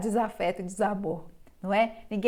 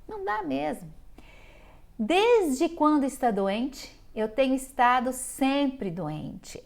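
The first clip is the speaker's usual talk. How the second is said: drawn-out slow whine; slow complaining quote; 4 semitones higher, 3 dB louder, slower than usual. Retold or imitated. Patient moaning she's always been ill, slow and drawn out. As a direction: stretch it out like an endless complaint.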